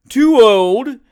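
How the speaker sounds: loud, high affronted cry; outraged cry at being called old; outraged exclaim. Acted. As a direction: shout back, deeply insulted. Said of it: "exclaimed Mister Tupman".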